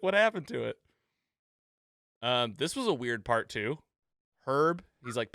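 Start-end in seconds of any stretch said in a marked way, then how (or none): 0.0-0.8 higher, voiced-up puzzled question; light imitation of a confused audience member; mock baffled spectator. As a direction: ask in a put-on dazed voice.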